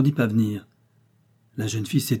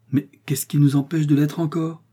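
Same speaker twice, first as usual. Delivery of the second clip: raised, insistent protest; higher-pitched spirited objection; exclaiming; 5 semitones higher, 4 dB louder than usual. A narrator's reading. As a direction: cry out the question eagerly. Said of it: "S'écria Jeannine".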